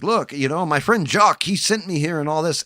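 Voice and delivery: French guy pleading with the soldiers, voice rising. Retold or imitated. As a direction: beg them to let him go, voice climbing. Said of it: "And the French guy's like".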